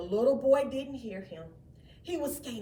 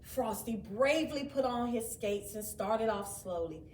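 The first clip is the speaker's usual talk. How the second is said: usual speaking voice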